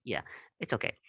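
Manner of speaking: ordinary delivery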